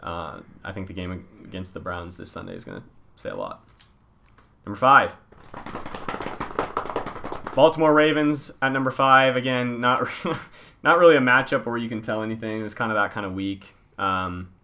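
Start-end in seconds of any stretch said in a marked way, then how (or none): 4.7-9.3 top ten countdown. Acted. Announces power rankings countdown.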